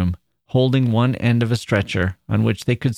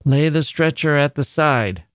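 put-on higher, curt old manager's order; manager snapping; 5 semitones higher than usual. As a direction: snap out the order like an old foreman. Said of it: "snapped the manager".

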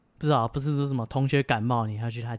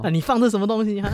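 annoyed customer; 7 semitones higher than usual. Acted. Acted customer complaint.

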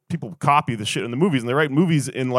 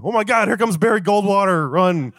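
high, laughing mock alarm; a pitched-up, hammed-up warning; mock panic; 7 semitones higher than usual. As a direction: yelp it like you're fleeing. Panicked chair rider.

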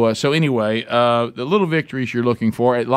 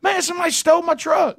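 much higher, hammed-up panicked voice; mock panic. Man who can't find his truck, hammed-up panic. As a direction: play the panic big and squeaky. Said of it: "I said".

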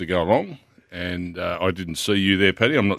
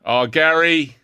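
mate's voice; 6 semitones higher, 4 dB louder, much slower than usual. Retold or imitated. Voicing mate on phone.

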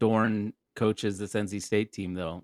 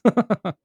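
burst of laughter; 10 semitones higher, 6 dB louder than usual. Real, not acted. Laughs at the mockery.

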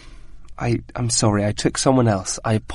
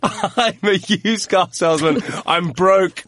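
laughing; 2 semitones higher, slower than usual. Pretend. Laughing, taking on the character.

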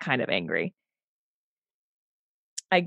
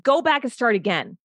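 angry order; 7 semitones higher than usual. Retold or imitated. Auditioning singer to accompanist after messing up, angry order. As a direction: snap out a mock-angry order. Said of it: "so fucking blind in my own anger".

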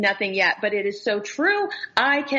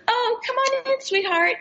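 put-on sugary, much higher shop-girl coo; condescending sweet talk; 13 semitones higher than usual. Imitating the dressing-room girl talking down to her, sugary. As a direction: coo it down at her like she's a child. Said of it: "that is so condescending to me".

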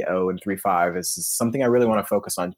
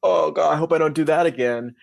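high, louder whiny worry; 7 semitones higher, 4 dB louder than usual. Retold or imitated. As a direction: whine like a nervous player. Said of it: "that whiny voice that you just did".

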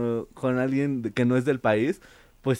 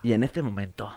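lower, softer nature-documentary narration; documentary narrator; 5 semitones lower, 5 dB quieter than usual. Acted. Host narrates audience rivalry like a documentary, documentary narrator. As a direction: narrate it like a wildlife programme. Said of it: "como en Discovery Channel".

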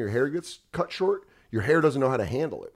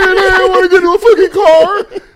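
freaked-out dog voice; 13 dB louder, faster than usual. Retold or imitated. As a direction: squeal it like a panicking dog. Voicing the dog.

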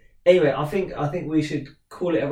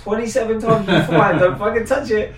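laughing, higher and louder; 6 semitones higher, 7 dB louder than usual. Real, not acted. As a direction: laugh through the telling-off. Laughing, retelling warning his kid.

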